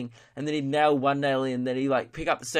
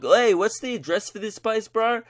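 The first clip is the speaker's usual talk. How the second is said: mock yell, pitched up; imitated calling out; 7 semitones higher than usual. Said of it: "yelling out to the staff members".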